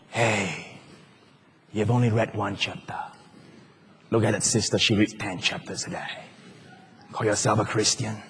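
low, soft taunting devil voice; accusing devil. Pretend. Devil taunting the believer, low soft accusing voice. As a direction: needle the believer in a low, hushed sneer. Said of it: "this is how it comes".

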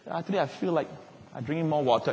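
dreamily pondering a passing fancy; mock musing. Pastor imitating a believer musing softly. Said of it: "you feel like".

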